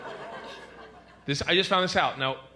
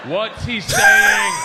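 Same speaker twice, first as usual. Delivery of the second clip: very high, drawn-out yell; insistent shout; 11 dB louder, much slower than usual. Acted. Traveler pressing the stranger for a translation, slow high shout. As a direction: shout each word slowly, hammed up.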